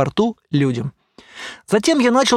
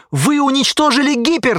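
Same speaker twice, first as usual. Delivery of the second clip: high-pitched outcry; urgent, raised-pitch exclamation; mild shout; 7 semitones higher than usual. A narrator's reading. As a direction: cry out in alarm without full volume. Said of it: "закричал я".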